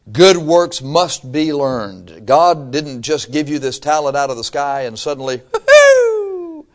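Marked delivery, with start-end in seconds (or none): 5.5-6.7 very high, loud "hoo-hoo"; mock magical whoop. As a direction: whoop as if a gift dropped from the sky. Mimes talent suddenly falling from sky.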